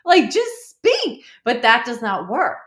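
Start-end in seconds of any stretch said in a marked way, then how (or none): 0.0-1.2 high, drawn-out, loud plea to speak; exasperated plea. Retold or imitated. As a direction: stretch it out in high-pitched exasperation. Acting out exasperation at silent husband.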